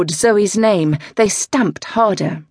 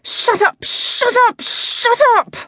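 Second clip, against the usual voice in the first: much higher, loud repeated screaming; furious shout; 4 dB louder than usual. Acted. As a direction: scream it over and over, losing it. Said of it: "Zoe exploded".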